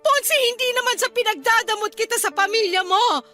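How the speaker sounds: crying through an emotional appeal; tearful pleading. Acted. Wife begging husband for time as just their own family, tearful pleading. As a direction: plead with him, sobs breaking between phrases.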